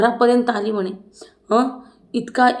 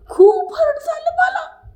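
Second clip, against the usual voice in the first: mock crying, very high and drawn out; hammed-up squeaky sob through the words; Deepali's tearful wail; 3 dB louder, slower than usual. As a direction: wail it slowly like a melodramatic crybaby. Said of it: "असं ती म्हणत होती दीपाली".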